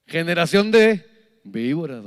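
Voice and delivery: preacher thundering at the crowd; denouncing shout. Acted. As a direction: call them a brood of vipers. John rebukes the crowd.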